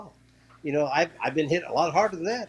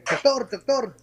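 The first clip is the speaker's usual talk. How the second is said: higher, raised call for help; imitated calling out; 6 semitones higher, 5 dB louder than usual. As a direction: call for a doctor, as retold. Imitates people calling for a doctor.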